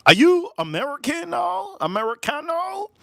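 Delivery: high-pitched questioning stranger; random Mexican stranger. Retold or imitated. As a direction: call it out high as an excited street stranger. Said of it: "some random dude came up to me and goes".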